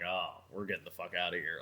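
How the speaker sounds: low, soft muttered getaway line; muttered to self. Retold or imitated. To himself as the girlfriend argues, low mutter. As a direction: mutter it under your breath, ready to bolt. Inner thought leaving the scene.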